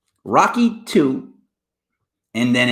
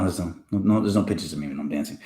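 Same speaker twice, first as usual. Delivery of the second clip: ordinary talk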